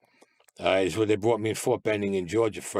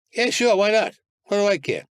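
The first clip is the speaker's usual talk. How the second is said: much higher and louder, tossing off a quick yes; eager, careless yes; 10 semitones higher, 5 dB louder than usual. Retold or imitated. Prisoner offered freedom tells lawyer, eager and careless. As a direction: jump at the offer like it costs nothing.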